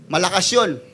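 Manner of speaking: usual speaking voice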